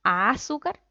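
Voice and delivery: azúcar as Á-zucar, long loud A; stress on first syllable. Shown as a demonstration. Teacher offering a wrong option, stressed Ázucar. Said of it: "¿Dónde cargamos la voz?".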